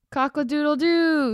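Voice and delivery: high, happy cockerel crow; a light rooster call of joy; proud crow. Acted. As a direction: crow it out at last, triumphant. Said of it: "he crowed".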